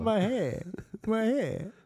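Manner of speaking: high prissy fuss; girly voice. Acted. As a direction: whine about her hair like a diva. Mock voicing a girl.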